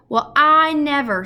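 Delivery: slow, high, loud astonished; marvel, drawing it out; impressed. A narrator's reading. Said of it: "with very much impressed".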